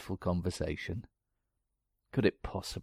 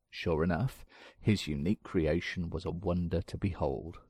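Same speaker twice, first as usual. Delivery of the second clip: ordinary talk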